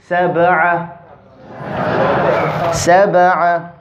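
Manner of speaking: "Sab'a" with a bounced b; qalqala on ba. Shown as a demonstration. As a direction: jerk the b of "Sab'a", adding no full vowel. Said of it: "we have to bounce it".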